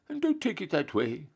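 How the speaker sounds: a lofty, scandalised general handing down punishment; pompous Duke. Acted. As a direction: play the Duke shocked and stern. Duke reproached by Sam.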